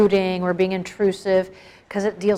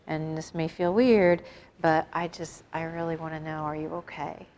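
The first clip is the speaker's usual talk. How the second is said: soft, caring question, growing quieter to the end; compassionate; 4 semitones lower, 6 dB quieter than usual. Retold or imitated. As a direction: ask gently, with real concern. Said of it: "come up to you with compassion and say".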